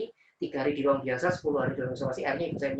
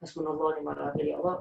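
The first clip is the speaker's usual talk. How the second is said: murmured dhikr; 5 dB quieter than usual. Retold or imitated. Son doing dhikr in the ambulance, soft murmured prayer. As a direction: repeat the prayer softly under the breath. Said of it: "di ambulan tuh saya dikira terus".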